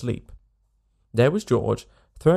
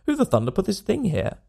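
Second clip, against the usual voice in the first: startled outburst; 7 semitones higher than usual. A narrator's reading. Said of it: "George in the bath".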